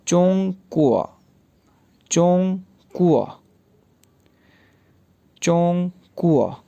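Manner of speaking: चोंग कुआ said slowly with a falling चोंग; slow Chinese demo. Shown as a demonstration. Teacher drilling चोंग कुआ for students, slow. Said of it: "क्या pronounce किया जाता है".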